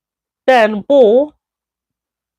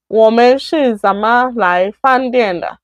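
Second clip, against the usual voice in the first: usual speaking voice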